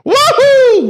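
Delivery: loud, very high cartoon whoop; hammed-up falsetto cheer; mock Mario wahoo. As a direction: whoop it like a game plumber. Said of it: "the Wahoo is perfect".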